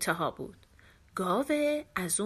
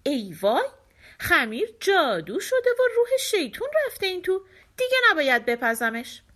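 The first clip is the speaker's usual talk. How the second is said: frightened mother; 7 semitones higher, 4 dB louder than usual. A narrator's reading. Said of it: "ترسید و با خودش گفت".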